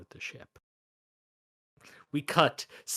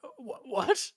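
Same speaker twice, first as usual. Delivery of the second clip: pleading crippled man; 13 semitones higher than usual. Acted. Crippled man accusing Lorne of broken promises, high pleading. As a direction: beg him in a high, strained voice.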